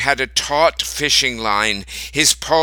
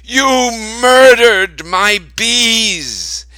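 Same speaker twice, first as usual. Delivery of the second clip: high, loud, slow anguished accusation; Grandpa crying out; 11 semitones higher, 8 dB louder, much slower than usual. A narrator's reading. Grandpa accuses Grandma of killing his bees, loud high wail. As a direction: drag it out in grief and fury. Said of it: "Grandpa cried".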